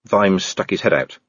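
ordinary delivery

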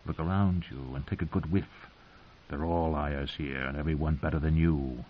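low, quiet rasping voice; rough rasp. A narrator's reading. The hound warning her about liars, as remembered, low rough rasp. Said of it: "the rough rasp of his voice".

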